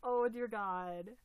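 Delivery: usual speaking voice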